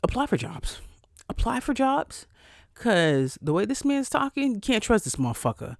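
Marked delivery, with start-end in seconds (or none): 0.0-0.8 intuition whispering. Retold or imitated. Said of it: "My intuition said". 1.3-2.2 high, odd inner voice; high voice of her own intuition. Retold or imitated. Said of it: "My intuition said".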